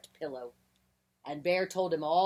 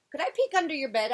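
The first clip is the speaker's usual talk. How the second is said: high, quick, louder question; eager request; 9 semitones higher, 7 dB louder, faster than usual. A narrator's reading. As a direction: ask quickly in a high mouse voice. Mouse eagerly asks Bear to check.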